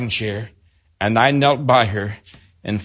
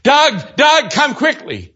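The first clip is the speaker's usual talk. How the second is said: high, loud urgent calling of a name; retold scream; 10 semitones higher, 5 dB louder, slower than usual. Wife calling her husband to see their sick baby, high urgent mock scream. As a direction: call him in from the other room, frightened. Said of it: "I heard you scream".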